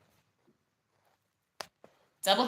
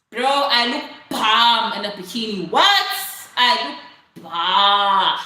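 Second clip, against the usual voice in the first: loud, emphatic boast, last word drawn out; playful bragging; 2 semitones higher, 9 dB louder, slower than usual. Real, not acted. Vlogger bragging to a viewer about bikini looks, playfully over the top.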